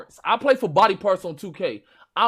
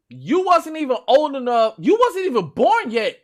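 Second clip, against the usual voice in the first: raised, higher-pitched disbelief; loud scoffing; 6 semitones higher, 6 dB louder than usual. Real, not acted. Older gamer roasting a youngster over Super Monkey Ball, incredulous. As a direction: scoff loudly at the youngster's nerve.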